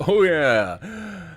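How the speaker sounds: big booming laughing "oh yeah"; loud "oh yeah" imitation. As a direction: shout it out like the alert sound. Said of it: "heard the "oh yeah".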